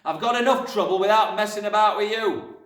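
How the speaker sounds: loud, high-pushed exasperation; fed-up father's bellow; angry shout. A narrator's reading. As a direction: roar at a nagging child. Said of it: "the father shouted".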